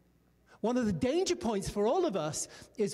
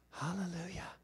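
soft hallelujah; 3 semitones lower, 9 dB quieter than usual. Real, not acted. Quiet praise after blessing story.

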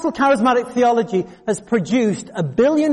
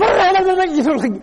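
high mock babble of tongues; sudden high-pitched gibbering noise; imitated tongues outburst; 7 semitones higher than usual. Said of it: "burst out speaking in tongues".

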